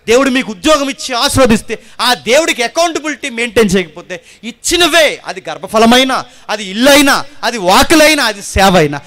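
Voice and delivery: loud, high sermon cry to the congregation; loud pleading preacher; fiery preaching. Acted. Preaching at full force.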